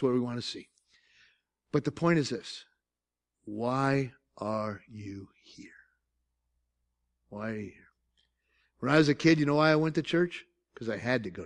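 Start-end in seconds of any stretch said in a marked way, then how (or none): 3.4-5.7 hushed, slow whispered question; slow intimate whisper. Acted. Preacher drops to whisper for emphasis. 7.3-7.9 a softer whisper, almost gone; fading whispered echo. Acted. Repeated whispered question.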